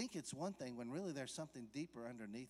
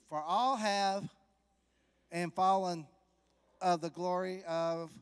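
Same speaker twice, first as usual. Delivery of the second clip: loud, slow, drawn-out verse recital; preaching at full force; 3 semitones higher, 11 dB louder, slower than usual. Acted. Preacher booming a verse to the congregation. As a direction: boom the scripture out slowly.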